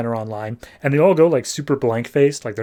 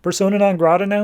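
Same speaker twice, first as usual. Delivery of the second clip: worried high voice; 6 semitones higher, faster than usual. Retold or imitated. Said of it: "they're all just like".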